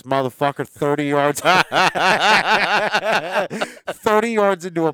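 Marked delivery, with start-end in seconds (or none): 1.5-3.8 loud burst of real laughter, rising; big laugh. Real, not acted. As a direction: crack up at your own bad shot. Laughing at own shanked drive.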